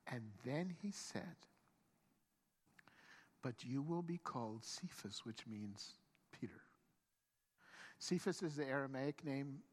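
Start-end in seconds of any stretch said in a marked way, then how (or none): 3.4-6.6 low, soft pronouncement; solemn renaming. Acted. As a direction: lower the voice and bestow the name gravely. Jesus solemnly renames Simon.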